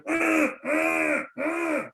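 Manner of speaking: imitated high muffled groans; distressed casualty moans. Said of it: "I can hear the casualty is kind of going".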